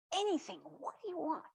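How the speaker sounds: high, keen reply, pitched well up; eager. Acted. First little soul answering the second soul's request, eagerly. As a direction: jump at the chance to help.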